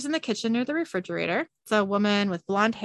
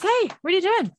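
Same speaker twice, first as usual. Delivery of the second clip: startled high protest; 11 semitones higher, 4 dB louder, faster than usual. Acted. Robin startled, pulled away.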